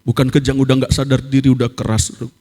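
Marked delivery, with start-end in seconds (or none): none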